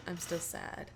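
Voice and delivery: a touch of a sulky dog; mock sad mumble. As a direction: mope it out under your breath. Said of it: "he's like".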